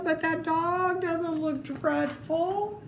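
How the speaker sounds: ordinary delivery, nothing marked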